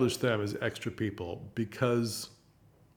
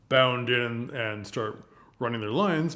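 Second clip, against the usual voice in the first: usual speaking voice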